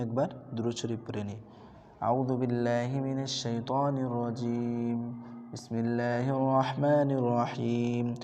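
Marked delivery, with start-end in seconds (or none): 2.0-8.1 slow measured Arabic recitation. Acted. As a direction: recite slowly and reverently. Opens class with recitation. (ধীরে তিলাওয়াত)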